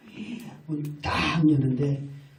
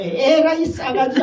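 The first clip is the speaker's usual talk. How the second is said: angry curse; 9 semitones higher, 8 dB louder, slower than usual. Retold or imitated. Father cursing at rude child.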